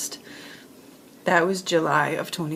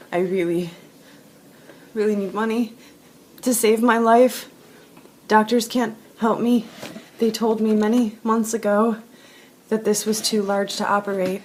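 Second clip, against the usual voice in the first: ordinary talk, nothing marked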